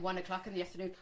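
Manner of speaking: normal talking voice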